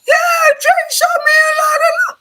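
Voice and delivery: very high, loud mimicry, squealed; loud, squeaky mockery of a man's voice; mocking high-pitched voice. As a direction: mimic him in an exaggerated falsetto shout. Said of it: "turned his bitch off, his bitch voice on, on high pitch".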